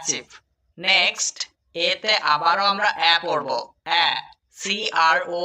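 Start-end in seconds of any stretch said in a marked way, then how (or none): none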